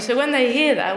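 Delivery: ordinary talk, nothing marked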